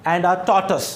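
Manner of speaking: normal talking voice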